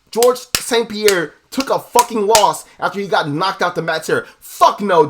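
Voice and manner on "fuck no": a loud blunt refusal, barked out; imitated shout. Fighter rejecting ever losing again, in a loud shout. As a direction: throw it out hard like a fighter refusing. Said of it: "George Saint Pierre says".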